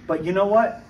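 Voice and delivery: ordinary talk, nothing marked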